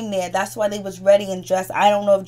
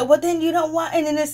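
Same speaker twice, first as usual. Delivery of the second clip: higher mock taunt; a hammed-up dare; retold challenge; 8 semitones higher, 4 dB louder, faster than usual.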